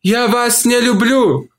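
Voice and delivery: high, slow woman's voice; Olivia's reply. A narrator's reading. Olivia tells Mark she doesn't love him, high and slow. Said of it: "ответила она".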